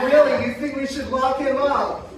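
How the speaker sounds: usual speaking voice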